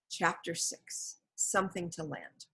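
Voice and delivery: slow, soft, unvoiced heading; chapter title. A narrator's reading. Narrator reading the chapter heading, hushed. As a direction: announce the chapter quietly.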